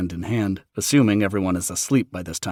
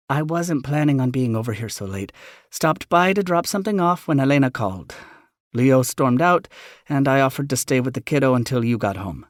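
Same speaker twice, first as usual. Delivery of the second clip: higher motherly voice; 6 semitones higher, 5 dB louder than usual. Acted. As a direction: mom explaining.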